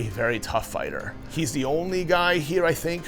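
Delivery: ordinary talk, nothing marked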